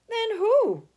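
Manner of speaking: loud, high eager cry; cried out. A narrator's reading. Charlie begging Grandpa Joe for the answer, crying out. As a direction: burst out wanting to know. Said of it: "cried Charlie".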